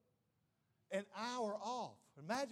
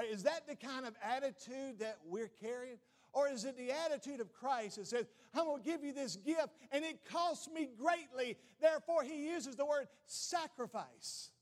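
preaching at full force; 6 semitones higher, 5 dB louder than usual. Acted. Builds into preaching.

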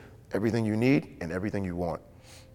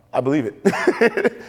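awed belief; much slower than usual. Acted. Abraham awed by the stars.

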